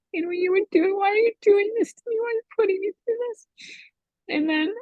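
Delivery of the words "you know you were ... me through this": much higher, fast, anguished outcry; pleading cry, rushed and high; retold screaming. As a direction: relive the desperate yell at God. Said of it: "screaming to the Lord".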